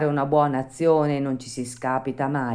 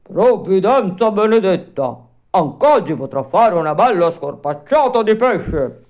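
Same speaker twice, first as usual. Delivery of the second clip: gleeful shout; 4 semitones higher, 8 dB louder than usual. A narrator's reading. The monstrous fisherman gloating over his full net, loud and gleeful. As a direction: crow over the catch. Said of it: "gridò tutto contento".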